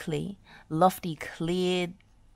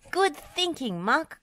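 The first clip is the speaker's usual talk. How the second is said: excited cry; 10 semitones higher than usual. Acted. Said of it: "cried Wendy".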